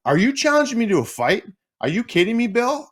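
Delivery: high disbelieving outburst; incredulous. Retold or imitated. Player to referee, high stunned disbelief. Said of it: "And I go".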